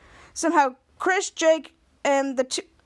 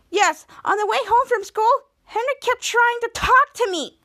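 higher-pitched indignant tattling; acted whiny complaint; 10 semitones higher, 3 dB louder than usual. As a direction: complain like an annoyed little sister. Sister complains to mother about brother.

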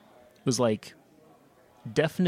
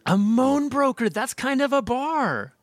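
impressed; 12 semitones higher, 4 dB louder, slower than usual. Real, not acted. Savoring a clever phrase.

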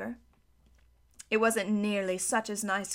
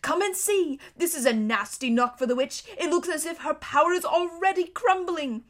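higher, bubbling with glee; nearly dancing with joy; delighted; 5 semitones higher than usual. A narrator's reading. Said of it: "almost dancing with delight".

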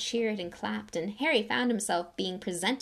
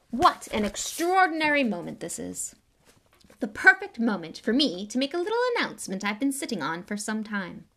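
vain celebrity; 2 semitones higher, 5 dB louder than usual. Acted. Lockhart announcing to the crowd, preening and loud.

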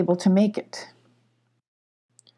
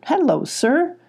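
a little louder; 7 semitones higher, slower than usual. A narrator's reading. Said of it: "Molly repeated a little louder".

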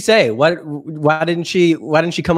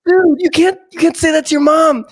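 high, louder shocked protest; shocked kid; 9 semitones higher, 4 dB louder than usual. Retold or imitated. Boy tells friend he can't swear at mom, shocked high voice. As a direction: gasp out the scolding in disbelief.

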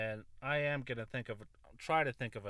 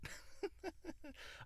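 high, airy burst of laughter; high breathy laugh; 8 dB quieter than usual. Real, not acted. High breathy laugh after catching his own cuss.

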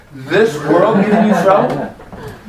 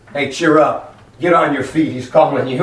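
low-pitched, casual relayed message; bystanders passing word; 4 semitones lower than usual. Acted. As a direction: tell him offhand, voice dropped. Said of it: "they said to him".

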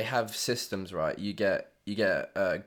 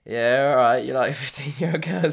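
loud, laughing taunt at the teacher; cheeky mockery; 4 semitones higher, 8 dB louder than usual. Boys mocking their teacher with a loud cheeky jeer. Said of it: "we'd be like".

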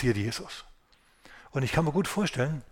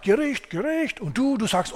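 higher, slow, shouted scolding, voice nearly cracking; shrill nagging rant; 10 semitones higher, 3 dB louder than usual. A narrator's reading. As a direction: whine and scold at God like a sulking prophet. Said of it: "Und Jona keift und zetert".

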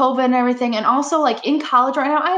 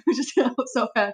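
laughing; 3 dB quieter than usual. Real, not acted. Giggling fit over sirens.

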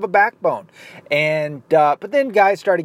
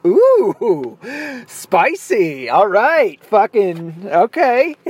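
amused mock-impressed reaction; 5 semitones higher, 4 dB louder, much slower than usual. His amused reaction to the retort.

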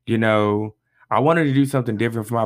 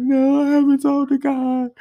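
put-on much higher, whiny little-kid voice of himself; mock sheepish whine; 4 dB louder than usual. Admitting to his best friend he skipped prayer, mock high whine. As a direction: whine it like a caught child. Acts own sheepish reply.

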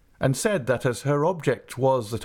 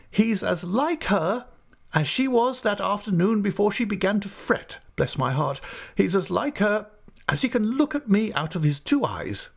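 emphatic aunt; 11 semitones higher than usual. Acted. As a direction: insist on it like the stern aunt.

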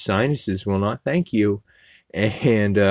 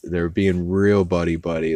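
normal talking voice

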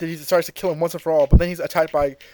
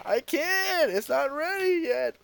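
Goku voice; 10 semitones higher, 8 dB quieter, slower than usual. Retold or imitated. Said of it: "And Goku says".

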